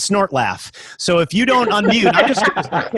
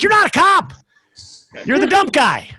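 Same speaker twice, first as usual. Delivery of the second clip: exasperated retort, high; 13 semitones higher than usual. Retold or imitated.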